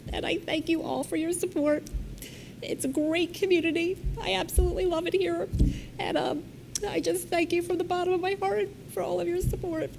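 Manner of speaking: real tears through a thank-you speech; choked-up farewell thanks; tearful, crying. Real, not acted. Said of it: "And I'm sorry that I'm crying".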